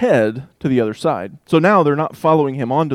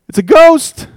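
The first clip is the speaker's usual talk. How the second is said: high, loud frightened shout; panicked yell of a ghost sighting; terrified cry; 12 dB louder than usual. A narrator's reading. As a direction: scream it like you've seen a ghost.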